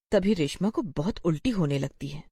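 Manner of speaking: plain reading voice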